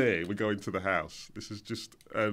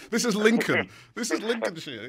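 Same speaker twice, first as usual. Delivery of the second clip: mock announcer; 9 semitones higher, 4 dB louder than usual. Jokey mock-formal announcement.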